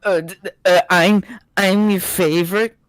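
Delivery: stammering high anime hero voice; anime protagonist. Retold or imitated. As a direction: stutter it out like a flustered anime boy. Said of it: "you made that sound like a fucking anime protagonist".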